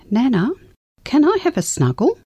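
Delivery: lightly voiced little girl, high; a hint of a small child asking sweetly; child's voice. Child voiced in grandma's story.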